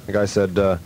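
usual speaking voice

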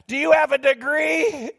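retold scoffing question, pitched high; mocking disbelief. Staff scoffing at a colleague's doctor plans, mock disbelief. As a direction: ask it in mock incredulity, voice jumping up. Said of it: "we all bust out laughing".

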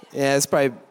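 ordinary delivery

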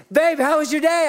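a hint of the wife asking; light mock wife.

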